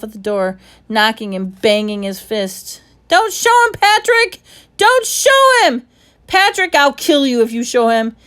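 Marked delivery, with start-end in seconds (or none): 3.1-8.2 very high, loud frantic screams; boy locked out, screaming at his friend; screaming at the top of his lungs. Acted. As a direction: scream through the door in desperation. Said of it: "he screamed at the top of his lungs".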